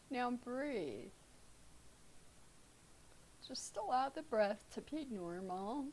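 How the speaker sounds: calm, unhurried meditation guide with long pauses; guided meditation. Real, not acted. Teacher leading students through breath awareness, guided meditation. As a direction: ease them into stillness, leaving space between phrases.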